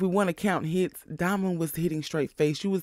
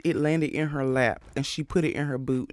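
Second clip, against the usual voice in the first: normal talking voice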